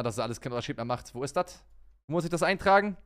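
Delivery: ordinary talk, nothing marked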